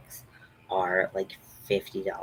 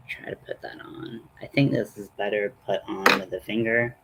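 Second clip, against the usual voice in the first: whispering; 8 semitones lower than usual. Real, not acted. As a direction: whisper it close to the mic. Whispers while applying makeup.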